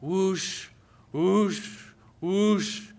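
mid-strength whoosh, imitating a machine; a growing whoosh. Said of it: "And then it got louder".

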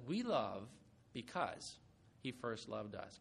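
low, soft scripture quote; softly quoting John before the congregation repeats it; quiet quote. Shown as a demonstration. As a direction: read it softly and low, as a first take. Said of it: "Can you say that with me?".

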